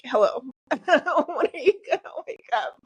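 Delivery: teary laughing high voice, a lightly voiced God; God's wake-up call. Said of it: "when God is, like, literally like".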